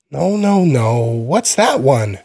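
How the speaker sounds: put-on high child's voice, slow and pleading at the end; insistent little boy. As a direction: whine it like a small boy who wants an answer. Insistent four-year-old asks.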